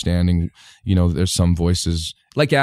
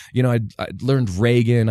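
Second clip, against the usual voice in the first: ordinary talk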